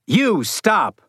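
high sharp shout. A narrator's reading. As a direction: call out to stop them. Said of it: "Leeward shouted".

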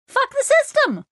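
high mock-defiant grunt of fuck the system; grunted defiance. A narrator's reading. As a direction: toss it off as a cheeky rebel cry. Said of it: "she grunted".

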